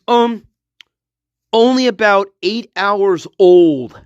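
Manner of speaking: ordinary talk, nothing marked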